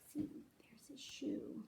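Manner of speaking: hushed aside to the listener; whisper. Real, not acted. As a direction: whisper it like a little secret. Reader's aside about the shoe.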